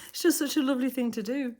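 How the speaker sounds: real gratitude welling up; tearful, emotional thanks. Real, not acted. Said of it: "Sorry, I get a bit emotional again".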